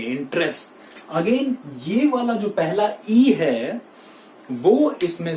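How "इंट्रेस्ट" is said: इंटरेस्ट in two syllables, in-trest; correct pronunciation. Shown as a demonstration. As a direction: drop the middle e in इंटरेस्ट. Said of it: "बोलेंगे". (इंटरेस्ट का सही उच्चारण, इन-ट्रेस्ट)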